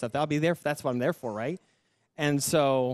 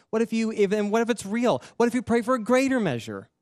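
raised, loud, mounting questions; insistent urging; 9 semitones higher, 5 dB louder than usual. Retold or imitated. The Lord challenging a frustrated student to pray, insistent urging. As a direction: press each question higher and harder. Retold urging from God, building.

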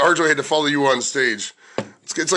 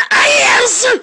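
high, loud burst into a scream; botched screaming-comedian impression; Sam Kinison; 7 dB louder than usual. Host mimicking co-host's attempted impression, high loud scream. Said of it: "impression of Don doing an impression of Sam Kinison".

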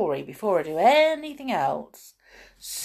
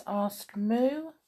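ordinary delivery

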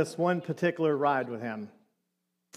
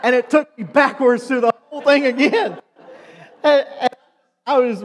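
high, louder laughing retelling; laughing while telling; 5 semitones higher, 5 dB louder than usual. Real, not acted. Pastor laughing as the ride goes backwards again. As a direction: laugh through the memory of the ride.

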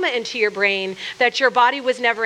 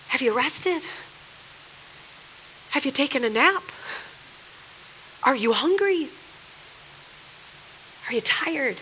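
tender God; 8 dB quieter than usual. Retold or imitated. God to a weary believer, soft and caring. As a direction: ask each one gently with pauses.

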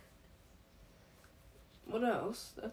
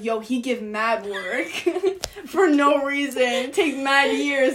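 drawn-out slang with a laugh; slangy exaggeration; 3 semitones higher, 7 dB louder, slower than usual. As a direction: play up the slang, slowly. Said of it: "and now I'd be like".

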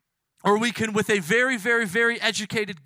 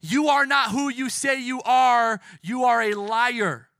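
raised, loud denunciation; shouted accusation; 4 semitones higher, 4 dB louder than usual. Acted. People shouting at Jesus on the cross, accusing. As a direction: shout it at him, pitched high. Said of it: "people shouting at him".

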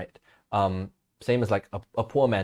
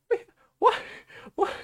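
squeaky, much higher mock double-take; a stunned cartoon "what?" ending in a laugh; mock shock; 9 dB louder than usual.

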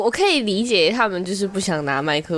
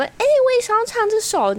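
a pitched-up eager mic-grabber; mock eager singer. To the KTV group, a mock-eager higher voice. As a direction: squeak it like someone cutting in on your song.

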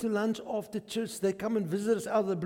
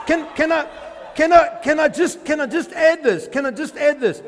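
laughing, much louder and higher; real excitement, near shouting; excited shout; 7 semitones higher, 10 dB louder than usual. Real, not acted. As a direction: burst in, giddy with the news.

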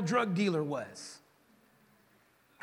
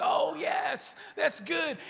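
mock thrilled churchgoer; 6 semitones higher, much slower than usual. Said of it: "Coming into church is".

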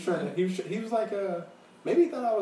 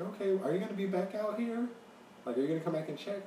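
higher-pitched imitation of the lady; retold lady; 9 semitones higher than usual. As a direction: lightly voice a needy lady asking, pitched up. Retelling the lady's question.

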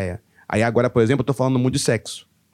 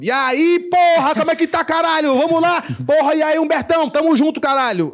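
much higher, loud hype greeting to friends; loud excited greeting; 13 semitones higher, 4 dB louder than usual. Shown as a demonstration. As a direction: burst in yelling hello like among buddies. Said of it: "Não, eu falo". (cumprimento animado e alto, bem mais agudo)

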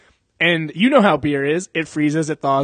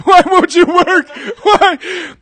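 high, loud, slow wailing through laughter; laughing wail at a car that won't start; mock crying plea; 14 semitones higher, 9 dB louder, much slower than usual.

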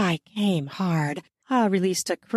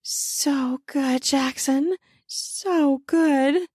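higher, blissful afterglow; soft gratified voice; 6 semitones higher, much slower than usual. Acted. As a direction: linger contentedly on each phrase. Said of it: "in a soft, gratified voice".

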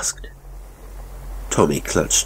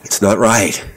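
restrained angry shout, a little raised; louder, indignant protest; 3 semitones higher, 5 dB louder than usual. A narrator's reading. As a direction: snap it out in anger. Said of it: "Elliot shouted angrily".

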